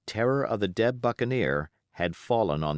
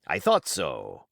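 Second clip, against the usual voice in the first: cried out; 6 semitones lower, slower than usual. Acted. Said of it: "cried the cook".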